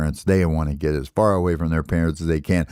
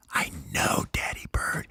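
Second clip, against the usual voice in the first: soft unvoiced whisper; 6 semitones lower, 9 dB quieter than usual. Baby bird whispering back in the dark nest. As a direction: breathe the words, barely there.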